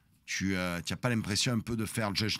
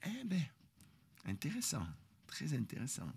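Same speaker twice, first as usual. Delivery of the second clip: soft, sly, amused interest; quietly smug intéressant; knowing mock interest; 3 semitones higher, 12 dB quieter than usual. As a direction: savour it like you've already won. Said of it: "je regarde en souriant".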